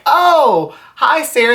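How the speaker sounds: retold squeal of recognition; surprised greeting. Greeting arriving friend, retold.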